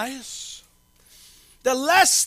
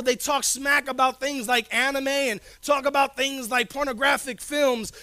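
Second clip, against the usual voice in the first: ordinary delivery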